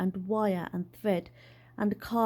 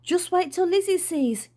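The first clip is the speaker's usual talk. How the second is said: excited; 8 semitones higher than usual. Acted. Dad excited about his daughter.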